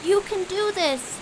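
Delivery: high, loud urgent shouting; sustained high-pitched rallying cry; screamed encouragement. A narrator's reading. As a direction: scream instructions to a friend in peril. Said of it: "screamed Quincy".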